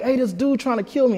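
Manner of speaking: high-pitched mock yell of alarm; high, urgent retold cry; retold yell for help. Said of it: "and I yelled".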